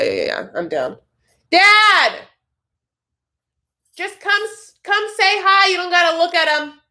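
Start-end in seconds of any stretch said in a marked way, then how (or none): 1.5-2.2 high, loud call across the house. Real, not acted. Calling her dad from another room. 4.0-6.8 much higher, raised coaxing call; calling out to dad. Real, not acted. Calling her dad to come say hi.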